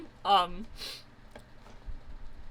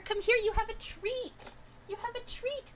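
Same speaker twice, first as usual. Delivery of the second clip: pet voice; 11 semitones higher, 5 dB quieter than usual. Real, not acted. Calling cat for treat.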